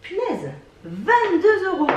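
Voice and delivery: reacting to an unexpectedly high price; surprised at the price. Real, not acted. (surprise haute et appuyée devant le prix)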